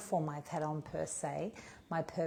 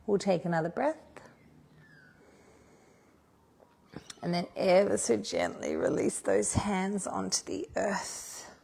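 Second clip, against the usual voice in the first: yoga cueing; 6 dB louder than usual. Acted. Leads yoga moves.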